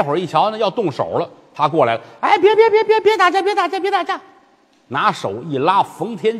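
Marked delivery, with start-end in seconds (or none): 2.2-4.2 put-on high child's voice, stop the fight; little kid pleading. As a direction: pipe up like a small boy.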